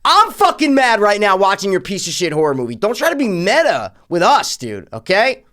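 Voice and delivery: loud, high indignant rant; mock angry retort. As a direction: yell back at the screen in exasperation. Ranting back at the movie.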